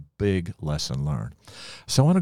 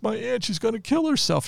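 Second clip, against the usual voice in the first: much higher voice, lightly imitating an upset child; a hint of a distraught little girl, pitched up high; imitating crying girl. Ten-year-old niece tells visitor about her aunt, imitated crying. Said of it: "she was crying".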